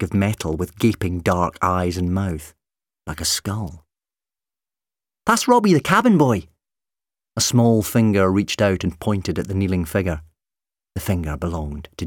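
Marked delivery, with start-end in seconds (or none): 5.3-6.5 put-on high-pitched child's voice; eager little boy. A narrator's reading. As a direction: pipe up like a keen young boy. Small boy eagerly names his hero.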